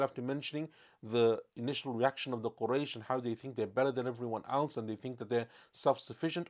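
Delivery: normal talking voice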